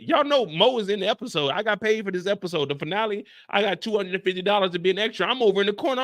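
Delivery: normal talking voice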